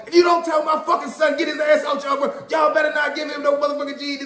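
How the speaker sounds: mock yell as the angry mom on the phone; mother going off. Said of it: "my mother going off through the phone".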